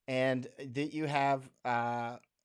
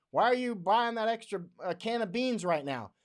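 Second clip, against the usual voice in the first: a bit higher, teasing mimicry; lightly mock a nagging question; mocking quote; 7 semitones higher than usual. Mocking people who question bean buying, mild sneer. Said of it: "the people that are like".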